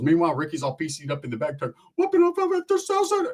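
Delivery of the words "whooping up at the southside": much higher, hyped squeal; squeaky Ricky voice. Retold or imitated. Ricky in the back seat, hyped squeaky whoop.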